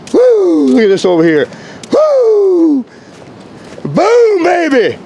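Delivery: high, whooping cheers; excited. Real, not acted. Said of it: "Sorry, I'm excited".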